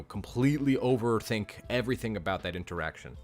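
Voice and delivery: ordinary delivery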